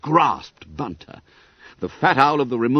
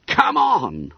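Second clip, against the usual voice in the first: raised, higher rallying cry; roar; 7 semitones higher, 4 dB louder than usual. A narrator's reading. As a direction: roar it to get the others moving. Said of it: "roared Bob".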